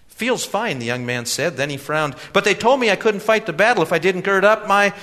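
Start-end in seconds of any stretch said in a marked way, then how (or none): 0.1-0.8 put-on much higher, earnest young man; eager young man voice. A narrator's reading. 2.3-4.9 put-on much higher, earnest young man; eager young man voice. A narrator's reading.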